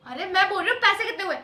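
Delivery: firm, loud demand with stress on पैसे; impatient question about the money; angry demand. Acted. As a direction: insist on पैसे, annoyed but not yet shouting. Demanding the bill amount.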